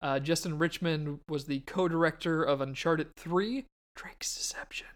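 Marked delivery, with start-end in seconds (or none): 4.0-4.9 whispered subtitle; whispering. Acted. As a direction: breathe the subtitle out.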